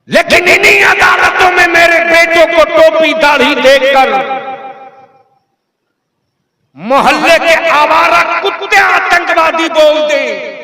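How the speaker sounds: high, loud sustained denunciation; fiery oratory. Real, not acted. Orator tells crowd of sons called terrorists, fiery oratory. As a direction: declaim in outrage to a rally.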